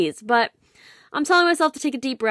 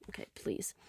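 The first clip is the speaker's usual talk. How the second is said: whispered, worn-out plea; exasperated whisper; 17 dB quieter than usual. Real, not acted. Reacting to his smiley face text, whispered exasperation. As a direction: breathe it out like you're done with him.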